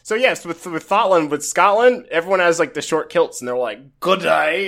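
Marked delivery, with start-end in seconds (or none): 4.0-4.7 put-on failed Scottish accent that slips toward British; clumsy mock-Scottish accent; bad Scottish accent. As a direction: attempt a Scottish greeting, badly. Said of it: "I can't do a Scottish accent".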